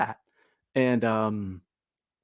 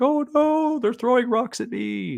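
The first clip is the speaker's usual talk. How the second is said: much higher, whiny mock complaint; hammed-up falsetto whining; mock whiny victim; 12 semitones higher than usual. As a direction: squeal it like a pearl-clutching victim. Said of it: "this person's like".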